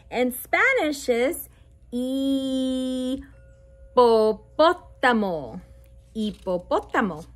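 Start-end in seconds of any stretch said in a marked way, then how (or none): 1.9-5.6 slowed demo. Shown as a demonstration. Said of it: "slow teaching demo". 6.1-7.2 quick repeat. Shown as a demonstration. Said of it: "fast repeat".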